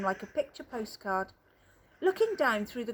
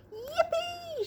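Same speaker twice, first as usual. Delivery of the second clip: high gleeful shout. Acted. Grandpa Joe cheers as the lift shoots up, high whoop. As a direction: squeal it way up high with joy. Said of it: "shouted Grandpa Joe".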